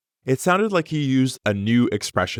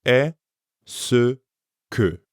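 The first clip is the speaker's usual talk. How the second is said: syllable by syllable. Shown as a demonstration. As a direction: split into separate syllables with a pause. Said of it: "Est-ce que is made up of three words".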